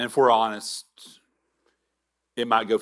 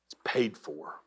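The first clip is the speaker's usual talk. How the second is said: softer, fading to a whisper; God's gentle hush; 4 semitones lower, 8 dB quieter than usual. Retold or imitated. God answering the sorry sinner, hushed whisper. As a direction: breathe it like an invitation. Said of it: "God ... says".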